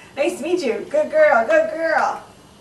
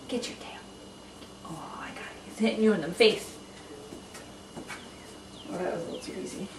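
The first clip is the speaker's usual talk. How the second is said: whispering; 4 semitones lower, 7 dB quieter, faster than usual. Real, not acted. Playing quietly with the dog.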